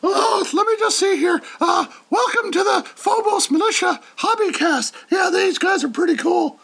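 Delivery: Venture Brothers character. Retold or imitated. Cartoon henchman reading the intro aloud. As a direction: yell it high and giddy. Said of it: "Venture Brothers".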